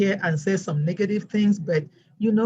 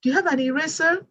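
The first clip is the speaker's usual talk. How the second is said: put-on high-pitched young student; quick, high question to a teacher; child student; 10 semitones higher than usual. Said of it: "a student did ask me".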